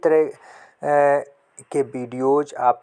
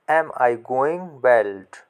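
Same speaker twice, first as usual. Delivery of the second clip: very slow, every word drawn out for learners; teacher reading the sentence to children, very slowly; slow model question; much slower than usual. Shown as a demonstration. Say it slowly, word by word. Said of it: "तो अगला sentence है आपका".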